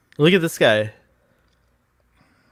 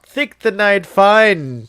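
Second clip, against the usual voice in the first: slow, louder, exaggerated Canadian voice; mock Canadian accent; 5 semitones higher, 6 dB louder, much slower than usual. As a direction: draw it out like a hammed-up Canadian.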